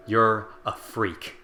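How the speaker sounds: slow, drawn-out sneer; sneering bully. A narrator's reading. Bully leaning in to sneer at Kaine. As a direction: spit the insult out slowly.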